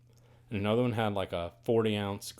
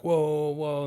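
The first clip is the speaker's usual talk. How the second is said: loud, higher whoa to stop the fight; calming call; 6 semitones higher, 6 dB louder than usual. Retold or imitated. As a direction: call out to calm them down. Angel calming the thugs.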